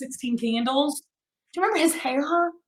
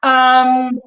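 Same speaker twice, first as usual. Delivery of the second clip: ordinary talk, nothing marked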